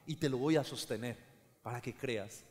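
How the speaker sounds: the Lord's voice. Retold or imitated. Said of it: "la voz del Señor".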